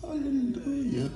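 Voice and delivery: soft alleluia. Shown as a demonstration. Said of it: "Vous dites, Alléluia".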